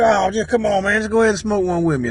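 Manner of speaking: high wheedling peer pressure; coaxing. Retold or imitated. Dealer coaxing him to stay.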